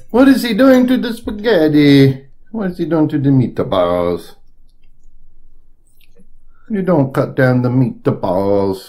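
high, slow outraged Italian; mock Italian chef outrage; Italian accent. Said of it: "some people, Italians, going".

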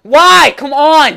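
high, loud frustrated shout; real gamer rage. Real, not acted. Gamer protesting at an opponent, furious shout. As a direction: burst out in angry protest. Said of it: "after raging that hard".

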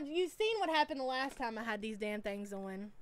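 normal talking voice

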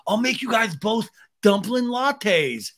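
high, slow, hammed-up offer; stretched-out mock eagerness; mock enthusiasm. Mocking Sandoval's eager offer.

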